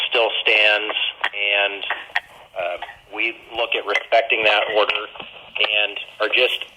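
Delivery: usual speaking voice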